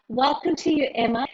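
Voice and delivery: normal talking voice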